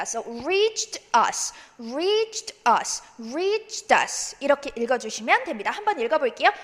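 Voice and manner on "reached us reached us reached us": "reached us" run together as "reach-tus"; linked "reached us". Shown as a demonstration. Teacher showing how to link the words, slow "reach-tus". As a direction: slowly carry the T of "reached" into "us". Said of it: "us로 이어주시면 됩니다".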